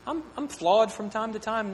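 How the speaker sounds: high, brushing-off voice; mock casual brush-off; dismissive shrug. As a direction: wave it away casually. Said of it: "some of us say".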